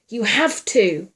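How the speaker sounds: slow, loud insistence; shouted back. A narrator's reading. Man urging frightened woman onward, shouted back. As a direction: shout it back, leaning on each word. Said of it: "Wyatt shouted back".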